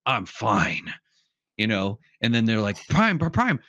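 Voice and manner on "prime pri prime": higher-pitched mock cries to a comrade; urgent calling out. Comrades call to fallen Optimus Prime, urgent calling.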